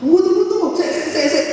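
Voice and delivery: playful call. Acted. Jesus voiced calling wait.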